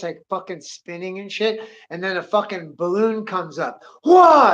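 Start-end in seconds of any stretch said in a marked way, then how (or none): 4.0-4.5 loud, high yelp of alarm; startled shout. Retold or imitated. Gunfighter startled by a balloon popping up, loud startled cry. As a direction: jump out of your skin.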